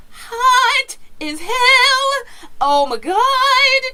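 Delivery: high, slow, sung-out gush of delight; giddy singsong. Real, not acted. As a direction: swoon over it in a high singing voice. Gushing over Bethesda conference.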